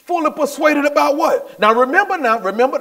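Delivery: high, eager preaching; getting excited. Real, not acted. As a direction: get carried away quizzing the congregation. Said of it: "I'm getting all excited now".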